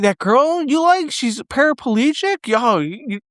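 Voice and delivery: high-pitched incredulous impression; Harland Williams. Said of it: "Harland Williams is like".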